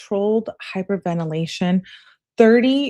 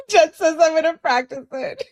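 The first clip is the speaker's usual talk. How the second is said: high-pitched, real giggly read; laughing while reading; 10 semitones higher, 4 dB louder than usual. Real, not acted. Reading Jen's comment to viewers, laughing.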